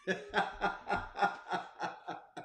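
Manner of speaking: quiet breathy chuckle; tired little laugh under the breath; soft laugh. Real, not acted. Laughing after I'm tired.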